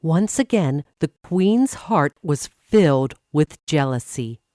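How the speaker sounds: ordinary delivery, nothing marked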